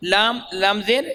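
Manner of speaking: usual speaking voice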